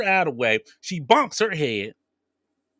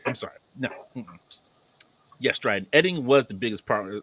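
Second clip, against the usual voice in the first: normal talking voice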